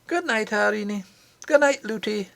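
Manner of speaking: put-on a boy miner saying good night to princess and nurse; cheery goodbye. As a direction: wave them off as he leaves. Curdie says goodbye.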